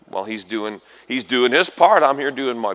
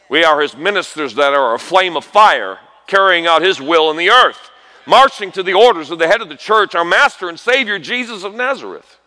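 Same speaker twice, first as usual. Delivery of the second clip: louder, higher rousing preacher's climax; preacher rallying the church as God's servants, rising to a loud climax; full-force preaching; 5 semitones higher, 5 dB louder than usual. Acted. Sermon climax.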